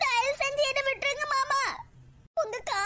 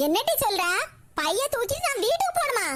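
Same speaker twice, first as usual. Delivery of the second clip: usual speaking voice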